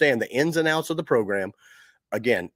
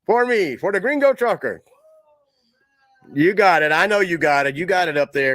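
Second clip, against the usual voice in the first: playful urging; 5 semitones higher, 5 dB louder than usual. Real, not acted. Urging Lewis to roll the video.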